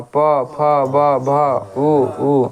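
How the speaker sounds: उ, उ, प, फ, ब, भ said slowly with both lips pressed together; lip sounds demo. Shown as a demonstration. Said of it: "बोलिए प". (दोनों होंठ सटाकर साफ़ उच्चारण)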